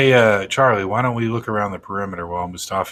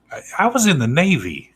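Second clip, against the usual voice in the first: higher, different-sounding indignant claim; offended veteran; 7 semitones higher than usual. Acted. Agent protests to the guard, pitched up and offended. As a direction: bristle at the insult.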